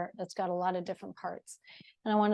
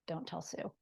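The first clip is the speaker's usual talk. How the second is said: hushed joking aside; 11 dB quieter than usual. Real, not acted. Instructor confides to class about her ankles, softer. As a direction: drop to a softer, secret aside.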